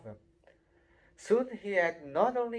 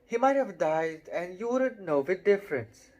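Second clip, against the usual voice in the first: disgusted; 5 semitones higher, 5 dB louder than usual. Acted. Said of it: "said Ron in disgust".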